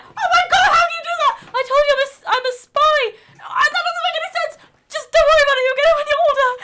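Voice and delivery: Billy wowed by Sophie's jump, then Sophie brushing him off; excited amazement. Acted. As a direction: gasp at the spy's jump. Improvised kids' story dialogue.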